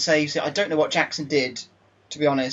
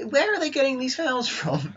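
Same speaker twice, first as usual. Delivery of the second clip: much higher, disbelieving complaint; incredulous; 11 semitones higher than usual. Real, not acted. Manager complaining about the fouls, incredulous and high. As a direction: protest the referee's calls in disbelief.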